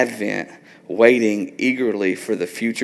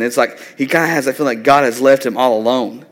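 ordinary talk

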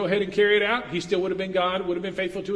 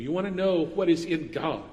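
normal talking voice

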